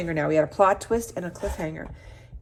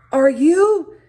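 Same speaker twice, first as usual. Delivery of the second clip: shocked; 10 semitones higher, 12 dB louder than usual. Acted. Mom cut off in shock.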